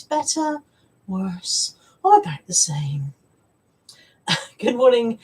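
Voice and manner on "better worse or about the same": eye-test optician voice. Retold or imitated. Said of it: "will recognise that tone of voice".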